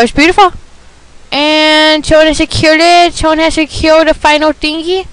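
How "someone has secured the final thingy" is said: usual speaking voice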